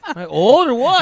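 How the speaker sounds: high, loud jokey protest; mock outraged shout. Joking with friends about his age, a loud mock protest. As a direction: yell it like you're offended, laughing.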